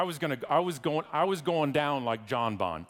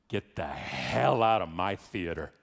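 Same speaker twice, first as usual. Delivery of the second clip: slow, low mock-menacing command; stern order; 6 semitones lower, slower than usual. Coworker ordering the mother out of her theater, stern slow command. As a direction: lean hard on each word to throw someone out.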